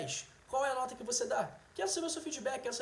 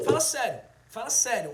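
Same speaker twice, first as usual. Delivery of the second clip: louder, playful exasperated protest; playfully indignant, pushing back; a hammed-up "come on, seriously"; mock outrage; 7 dB louder than usual. Mock outrage at age guesses.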